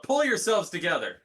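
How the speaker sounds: sharp in-character shout; yelling out. Acted. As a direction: yell it at the whole group to get a grip. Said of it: "I just yell out".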